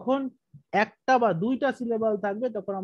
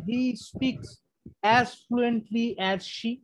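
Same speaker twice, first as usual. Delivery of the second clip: dictating slowly; 3 dB quieter, much slower than usual. Shown as a demonstration. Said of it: "আপনি অন্যভাবে করতে পারেন".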